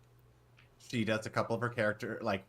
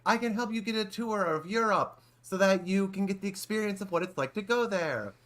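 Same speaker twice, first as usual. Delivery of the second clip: imitating a vague European accent, higher and louder; mock a vague European tour guide, pitched up; 10 semitones higher, 3 dB louder than usual. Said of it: "a vague European accent".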